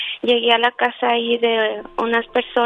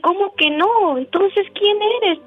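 startled question; 9 semitones higher than usual. Retold or imitated. Rosita confronts the apparition.

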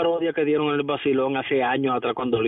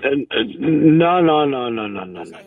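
loud protest; 2 semitones higher, 7 dB louder, slower than usual. Acted. Caller bursts in on air.

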